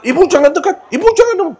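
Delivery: high, laughing plea to keep away; mock plea. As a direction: beg them off half laughing. Asking women not to come close.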